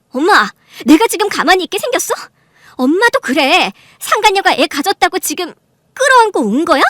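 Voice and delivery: loud, high, indignant tirade; angry outburst. Acted. Daughter accusing her mother of siding with the mistress, angry. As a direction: pile up accusing questions at full voice.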